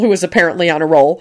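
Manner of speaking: usual speaking voice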